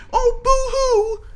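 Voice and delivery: put-on sneering baby-cry boo-hoo; mock crying. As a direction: taunt with a fake whiny sob. Mocking opponent's childhood in rap.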